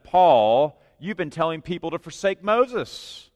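mock the rumor like an indignant crowd; accusing gossip.

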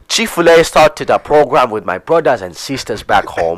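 imitated Ugandan accent, confiding sales pitch. Colleague stops customer at payment, mock Ugandan accent. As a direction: put on a Ugandan accent to make an appeal. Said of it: "with a Ugandan accent".

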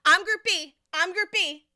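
loud, high insistent yell; retold yelling. Pushy woman yelling at the line, loud and high. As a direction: push in yelling your group. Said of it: "she starts yelling".

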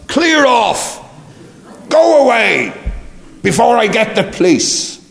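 a high, angry bellow that would wake the dead; furious shout. Retold or imitated. Father yelling at an intruder in his rose bushes, furious high shout. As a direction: roar him off the property. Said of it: "The shout was".

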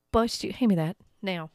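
ordinary talk, nothing marked

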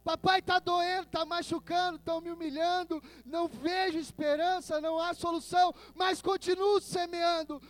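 high, softened pleading; pained pleading prayer. Acted. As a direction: plead like a hurting child to a father. Voices weeping sower praying to God. (súplica dolorida, voz aguda e contida)

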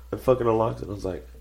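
ordinary delivery

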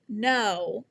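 ordinary talk

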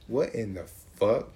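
ordinary talk

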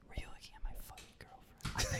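unvoiced whispered frat bro threat; a mock frat boy whispering menace into the mic. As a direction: hiss the threat like a menacing ASMR video. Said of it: "whispering about fighting each other".

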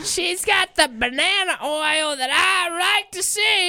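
very high, slow hammed-up catcall; catcalling greaser. Joining the sketch as a greaser with an innuendo, hammed-up catcall.

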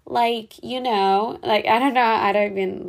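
put-on quick demo of valley girl speak; valley girl accent. Shown as a demonstration. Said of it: "that's a valley girl accent".

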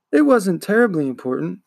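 ordinary delivery

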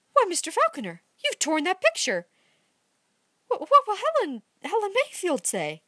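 Amy seeing the torn picture, aghast; shocked dismay. A narrator's reading. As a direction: gasp it out in dismay.

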